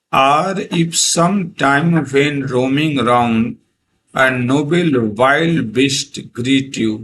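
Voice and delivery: very slow, every word spaced out; slow poem recital. Shown as a demonstration. Teacher reading a poem line to students, very slow. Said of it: "या यदि आप".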